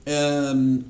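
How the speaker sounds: normal talking voice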